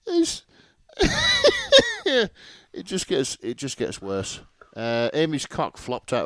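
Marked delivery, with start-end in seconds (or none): 1.0-2.7 loud, high burst of laughter; helpless shrieking laugh. Real, not acted. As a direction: lose it completely, cackling. Cracks up at the line.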